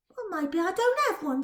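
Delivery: haughty. Acted.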